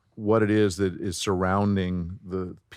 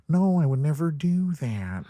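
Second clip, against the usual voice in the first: higher, drawn-out voice placed in the nose; nasal celebrity voice match, pitched up; Owen Wilson; 7 semitones higher than usual. Shown as a demonstration. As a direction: push it up into the nose, unhurried. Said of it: "his nose is gonna have an effect on his voice".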